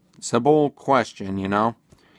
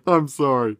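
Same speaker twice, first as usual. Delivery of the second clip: laughing apology; 3 semitones higher than usual. Real, not acted.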